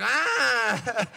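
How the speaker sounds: mock baby wail. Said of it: "the baby's like".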